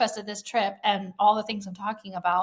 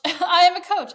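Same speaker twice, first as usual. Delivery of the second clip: laughing, much higher and louder, joyful shout; laughing declaration; 10 semitones higher, 10 dB louder than usual. Real, not acted. Host reliving her epiphany for listeners, laughing and shouting. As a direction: burst out laughing as you claim it. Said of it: "I had this inner knowing".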